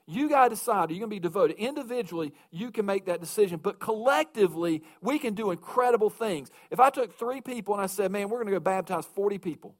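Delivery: rising pulpit fervor, pressing the congregation; preaching at full force. Acted. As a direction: hammer the call home from the pulpit. Preaching the call to devotion.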